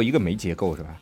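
ordinary talk, nothing marked

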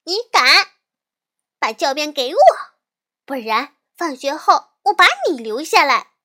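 little sister yelling a dare during the fight; shouting. Acted. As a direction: yell the dare right back at him. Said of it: "小熊妹妹大喊着".

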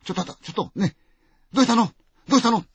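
high, loud, startled call; alarmed nurse calling out loud. Acted. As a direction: shout to stop her in panic. Nurse calls to attacking patient.

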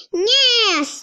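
loud high answering shout; soldiers cry out. A narrator's reading. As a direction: roar back yes to the general. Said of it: "they cry".

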